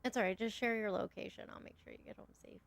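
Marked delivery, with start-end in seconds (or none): 1.5-2.6 whispering. Pretend. Teasing about a date with Cole.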